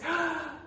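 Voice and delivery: quick high gasp of delight. A narrator's reading. Storyteller gasping with delight at the children's new clothes. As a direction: gasp with joy.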